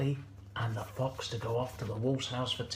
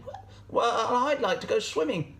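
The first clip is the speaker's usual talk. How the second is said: high, hesitant goat voice; Gladys the goat; 9 semitones higher than usual. Acted. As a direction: state the wish in a high goat voice. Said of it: "Gladys would say".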